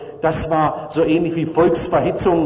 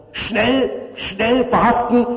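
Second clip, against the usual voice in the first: higher, urgent mock yell; mock shout; 6 semitones higher than usual. Prosecutor crying out to the court for arrest, a mild mock shout. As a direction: hurry them to arrest the men. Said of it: "schrie die Staatsanwaltschaft".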